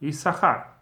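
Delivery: "Issachar" with a single s sound; pronounced as one. Shown as a demonstration. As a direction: say "Issachar" as if written with one sin. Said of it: "it's pronounced always as one".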